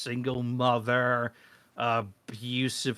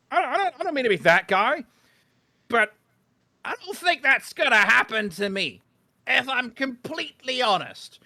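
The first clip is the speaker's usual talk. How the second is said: comic rant pitched way up; high-pitched protest; 10 semitones higher, 4 dB louder than usual. Acted. Man insisting he won't become that gym guy, high-pitched protest.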